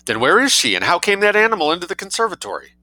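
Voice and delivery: high, forceful questioning; demanding. A narrator's reading. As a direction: demand an answer urgently. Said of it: "demanded the colonel".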